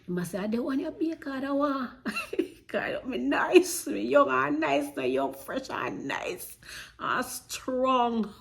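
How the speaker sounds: hammed-up, teasing boast, slowing down at the end; mock bragging. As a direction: show off mockingly. Mocking boast with laughter.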